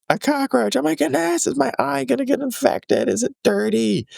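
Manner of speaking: put-on very high, squeaky panicked kid; imitated meltdown. Daughter learning it was a cockroach, mimicked freak-out. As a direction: mimic a child spiraling into panic, pitch way up. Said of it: "She freaked out".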